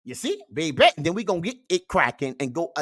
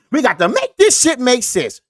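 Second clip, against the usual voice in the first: preachy shout; 8 semitones higher, 9 dB louder than usual. Acted. Catchphrase shouted to viewers.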